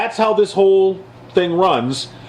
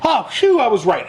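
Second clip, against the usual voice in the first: high, relieved mock gloat; mock Republicans; 8 semitones higher than usual. Mocking Republicans as they crow to Trump. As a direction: lampoon the smug I-told-you-so. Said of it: "they go".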